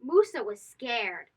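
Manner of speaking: plain reading voice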